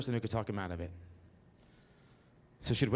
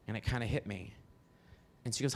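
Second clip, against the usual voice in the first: normal talking voice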